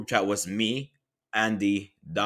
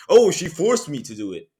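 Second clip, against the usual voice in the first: mocking whine; 6 semitones higher, 7 dB louder than usual. Mocking the liar's excuse.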